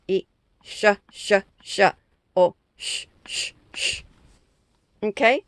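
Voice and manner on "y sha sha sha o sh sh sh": "sha" voiced, then "sh" fading to a breathy voiceless hiss; sha then hush. Shown as a demonstration. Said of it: "hay tres doble consonantes".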